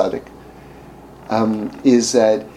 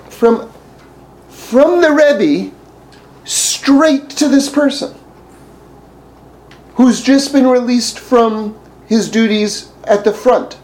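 amazed emphasis; 10 semitones higher, 3 dB louder, slower than usual. Real, not acted. Marvelling emphasis.

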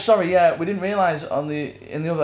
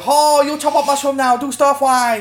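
much higher, louder mocking put-on voice; 12 semitones higher, 8 dB louder than usual. Mocking his friend with a put-on silly voice. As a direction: ham up a silly caricature voice. Mocking how he would talk.

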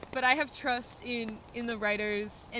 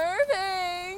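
hammed-up scream of the name, higher and louder; mock terrified cry; 9 semitones higher, 7 dB louder than usual. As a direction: fake horror, crying out the monster's name. Mock panic at the monster.

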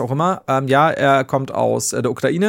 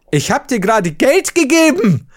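higher, louder, slowed angry retort; retold angry reply; 9 semitones higher, 5 dB louder than usual. Man angry at the beggar who asks for more, higher, louder retort. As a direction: snap back at him as if the anger is rising again. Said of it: "eine Wut in mir aufgestiegen".

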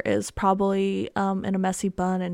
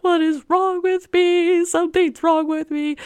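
higher and louder tearful wail; mock sobbing; 8 semitones higher, 7 dB louder than usual. She breaks down over the flood, a loud tearful wail. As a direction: wail it like you're bursting into tears. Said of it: "immediately burst into tears crying".

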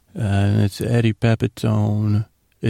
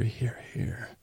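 very soft imitated whisper; hushed, voiceless little aside; quiet whisper; 14 dB quieter than usual. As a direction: whisper it barely audibly. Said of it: "it was a quiet whisper by Skylar".

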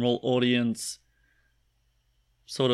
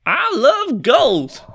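very high, loud, slow prospector voice; old prospector; 13 semitones higher, 11 dB louder, much slower than usual. Acted. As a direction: shriek it like a gold-crazed miner. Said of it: "your old-timey gold prospector voice".

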